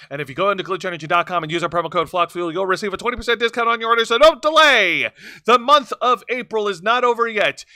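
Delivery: rapid promo disclaimer speed; fast ad read. Acted. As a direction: race through the promo code.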